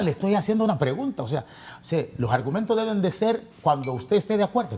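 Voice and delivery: high-pitched insistent arguing. Real, not acted. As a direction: argue with your pitch pushed up. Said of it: "Pero sin gritar".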